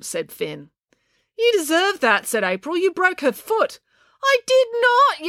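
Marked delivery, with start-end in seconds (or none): 1.4-3.8 put-on high childish squabbling voices; bickering kids. A narrator's reading. April and Finn bicker over the broken foot, high and indignant. 4.2-5.3 high slow indignant yell. A narrator's reading. Finn yells at April in slow, high protest. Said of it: "yelled Finn".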